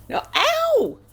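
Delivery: real startled yelp of pain. Real, not acted. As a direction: yelp sharply as teeth sink in. Puppy bites her.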